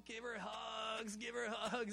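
mild retold shout, drawn out; calling the group to hug her; a touch of an excited call; retold happy call. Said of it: "we're like".

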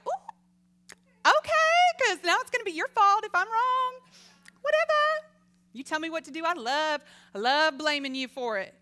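high, loud, sing-song mock voice; mock flippant victim. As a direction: play your old self, glib and passing the blame. Mocking her own victim self.

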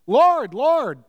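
emphatic plea. Retold or imitated. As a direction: cry it out like a desperate claimant. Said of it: "It's emphatic here".

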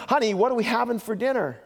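husband calls from across the room to test her hearing; calling across the room. Retold or imitated. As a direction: call out over the distance, mock husband. First call across the room.

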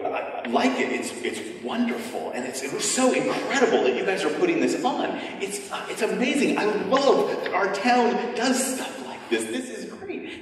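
rising, gushing, a little flustered praise; flustered bystander. Retold or imitated. Visitor answering the actor about the play, flustered gushing. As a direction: gush nervously like a caught-off-guard visitor. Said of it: "And you go".

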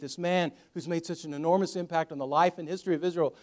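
ordinary talk

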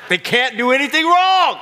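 loud, high mock triumph; joking shout. Real, not acted. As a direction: belt the punchline.

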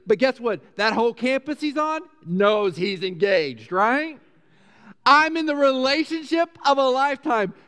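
high, loud, gushing excitement; excited engagement announcement. Acted. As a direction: shout it like you just got engaged. Acts out thrill of being engaged.

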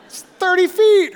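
high, loud mock yell; shouted reassurance. Friends calling up the height to a climber, shouted. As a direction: call it up from far below.

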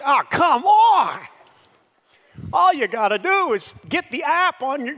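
high, loud laughing scoff; mock exasperation. Young person railing on old people, high exasperated scoff.

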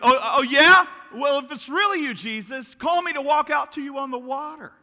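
loud, high-pitched dare; hammed-up impulsive disciple; brash challenge. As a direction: call out a cocky challenge. Peter acted out, challenging Jesus.

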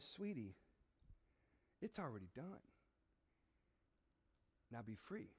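low, soft and tender, with long pauses; loving father. Acted. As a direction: release them gently, almost hushed. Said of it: "looks at you with loving tears".

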